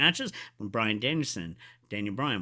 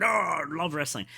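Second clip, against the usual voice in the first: roared opening, higher pitch; chest-pounding fan cry; 4 semitones higher, 3 dB louder than usual. Retold or imitated. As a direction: re-enact a pumped-up wrestling fan. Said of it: "pound my chest and".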